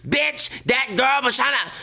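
high, sassy imitation of her own rant; mock the way she goes off on camera. Acting out her angry on-camera rant.

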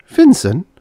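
high, loud startled question; astonished. A narrator's reading. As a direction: exclaim it in high-pitched disbelief. Startled on hearing his name.

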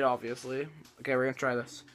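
speaking through fingers; partly muffled, pinched nose; hand over nose. Real, not acted. Seen 0: Shaker drops below chin after sniff; hand then covers mouth and nose.

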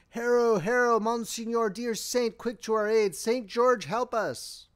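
high, loud pleading battle cry. A narrator's reading. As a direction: call on the saint for aid. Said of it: "heard the cry".

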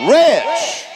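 high rising shout of rich. Real, not acted. Preacher urging the congregation to say it, loud rising shout. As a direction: belt out Rich, pitch soaring up. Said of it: "Say it out loud".

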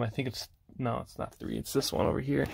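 quiet unvoiced mutter to self; muttered aside. Real, not acted. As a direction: mutter the correction under your breath. Correcting himself while searching shelf.

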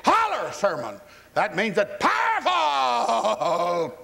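high, drawn-out preacher holler; stretched-out mock sermon wail. Shown as a demonstration. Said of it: "power is in the volume of your voice".

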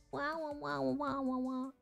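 normal talking voice

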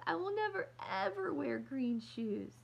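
very high then slowing, loud protest; wailing. Acted. Little girl wailing she will never wear green shoes. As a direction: wail out the refusal.

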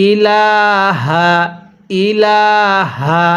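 ইলাহা slow, stretching লা by one alif; Quran recitation. Shown as a demonstration. Teacher showing viewers how to stretch ইলাহা, slow recitation. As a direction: draw out the লা of ইলাহা gently, no pull on the hamza. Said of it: "হামজার মধ্যে টান দিব না".

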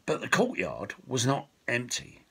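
ordinary delivery

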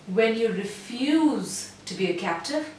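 "refuse" slow, then "to be a captive" quickly; slow refuse, fast captive. Shown as a demonstration. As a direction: linger on "refuse", then rush the rest. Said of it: "so refuse was slow".

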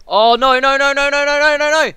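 loud, high mock-panicked no's; imitating a screaming player. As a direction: ham up a terrified viewer. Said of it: "but going".

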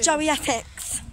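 slow, exaggerated Essex accent. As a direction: drag it out like an Essex lad.

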